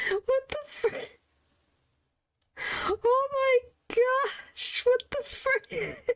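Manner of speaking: high, stretched squeal of disbelief; shocked squeal. Real, not acted. Streamer shocked by auto-translate.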